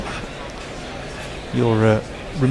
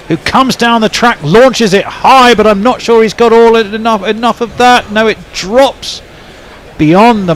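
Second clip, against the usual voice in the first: higher and louder as the ball goes up; excited call; 10 semitones higher, 7 dB louder than usual. Real, not acted. Commentator calls Billings' lofted drive live, excited. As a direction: rise with the shot, real excitement. Big shot called live on air.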